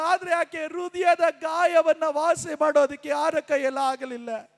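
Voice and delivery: hushed preaching. Real, not acted. Preacher drops to hushed telling. (ತಗ್ಗಿದ ದನಿಯ ಬೋಧನೆ)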